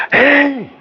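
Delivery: sharp high "Hey" to get attention; yell. Retold or imitated. Teacher showing a bully yelling at someone, sharp high call. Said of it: "right, I yell at you".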